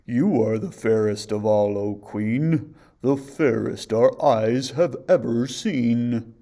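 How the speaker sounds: loud, slow, otherworldly pronouncement; solemn mirror oracle; magic mirror's voice. A narrator's reading. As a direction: intone like an enchanted mirror. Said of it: "the mirror answered".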